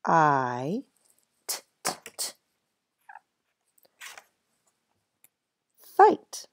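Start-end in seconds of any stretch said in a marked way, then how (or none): 1.5-6.5 "t, t, t" whispered, then "Fight" high; sounded then blended. Shown as a demonstration. Teacher sounds and blends fight for first graders. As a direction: tap out t's, then sing out the word. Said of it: "long I sound".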